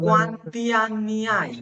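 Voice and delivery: slow, careful Italian Quanti anni hai; teacher modelling Italian for class, slowly; slow model phrase. Shown as a demonstration. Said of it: "এটা বয়স জানার ক্ষেত্রে".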